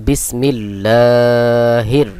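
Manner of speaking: slow recital, stretching লা before closing on হির; drawn-out recitation. Shown as a demonstration. Teacher joins the spelled syllables for learners, বিসমিল্লাহির slowly drawn out. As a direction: hold the long লা in বিসমিল্লাহির. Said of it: "লাম খাড়া জবর লা".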